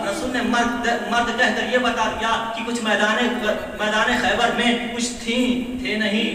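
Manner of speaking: loud, impassioned sermon climax; full-force preaching. Real, not acted. Preacher telling the crowd of Khyber, full-force preaching. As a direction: drive each point home loudly to the crowd.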